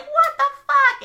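very high, loud shriek; shocked Stephanie. Retold or imitated. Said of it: "Stephanie's like".

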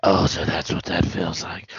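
low, throaty movie-hero rasp. Retold or imitated. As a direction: push it down into a hushed, throaty Batman voice. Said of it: "talking through a throaty voice".

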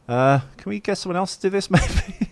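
high, tentative little plea with a laugh; mock reluctance. Interviewer imagining himself asked to do the jump, mock-timid. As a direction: jokingly try to back out.

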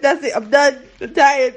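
usual speaking voice

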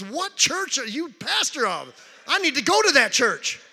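hammed-up eager stranger; excited stranger. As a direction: burst out wanting to come to that church. Man suddenly eager to join.

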